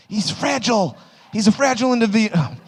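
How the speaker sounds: high, pitying impression of onlookers; mock concern. Audience member about fragile speaker, mock concern. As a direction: pitch it up as a protective bystander.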